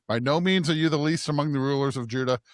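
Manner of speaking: ordinary talk, nothing marked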